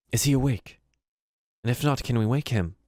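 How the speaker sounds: a touch of character voice, asking; Bati's question. Acted. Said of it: "Bati says".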